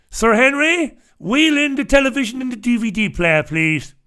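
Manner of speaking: high-pitched, hammed-up royal summons; mock Queen. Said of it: "There's the Queen".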